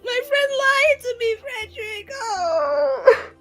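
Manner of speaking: high, drawn-out wail; hammed-up crying that ends in a moan; tearful lament.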